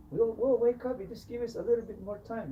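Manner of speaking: high, pleading clown voice; clown begging. Retold or imitated. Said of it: "The clown begs and pleads".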